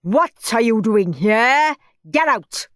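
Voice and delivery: loud, slow angry teacher; glowering. A narrator's reading. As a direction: bark it at the boy, slowly.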